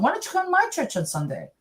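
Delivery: high, singled-out imitation of eager inviters; chorused invitation. Imitating three clients inviting her at the same time, high-pitched. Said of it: "they all said at the same time".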